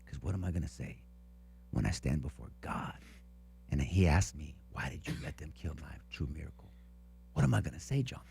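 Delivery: a quiet, earnest retelling of the guard's plea; hushed movie climax. Retold or imitated. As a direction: say it low like the big moment. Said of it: "Here's the part".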